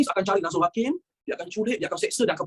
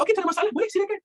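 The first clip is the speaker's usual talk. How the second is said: mock British approval; 11 semitones higher, faster than usual. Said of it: "British kata".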